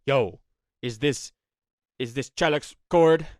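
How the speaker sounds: loud, punchy prank-call voice; prank caller. Retold or imitated. Anonymous voice calling a friend to prank him, loud put-on caller. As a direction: drag it out and hit the name hard.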